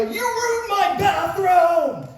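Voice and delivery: loud, high, very slow outraged yell; yelling owner. Retold or imitated. Pizza owner yelling at the coach in the van: slow, high, loud outrage. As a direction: play the furious pizza owner. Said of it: "he's yelling at you".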